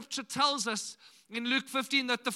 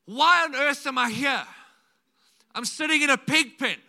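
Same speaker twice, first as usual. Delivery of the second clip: 1 prodigal son's outburst; 4 semitones higher, 10 dB louder than usual. Retold or imitated. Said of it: "He says".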